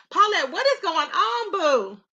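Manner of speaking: usual speaking voice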